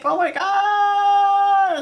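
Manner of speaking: drawn-out, high, loud gasp of disbelief; exaggerated shock. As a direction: stretch it out in mock amazement. Mock shock at cheap price.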